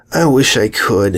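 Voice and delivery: plain reading voice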